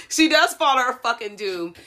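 high, loud, amused outburst; laughing. Real, not acted. Cracks up at the plot.

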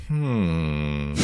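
long, low, soft thinking hum; pondering hum. Retold or imitated. Homer weighing up the transporter price, pondering hum. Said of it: "He's really, like, thinking about it".

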